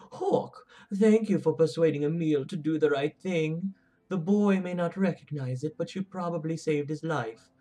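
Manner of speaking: put-on high-pitched, botched Orlesian woman's accent; awful female Orlesian accent. Player reading a thank-you letter aloud, awful female Orlesian accent. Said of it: "That was an awful, awful female Orlesian accent".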